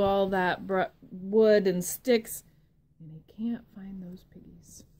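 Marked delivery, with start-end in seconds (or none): 3.0-4.8 hushed whisper; suspense whisper. Acted. Storyteller whispering to the children as the wolf searches.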